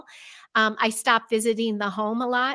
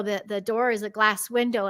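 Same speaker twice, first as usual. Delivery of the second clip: ordinary delivery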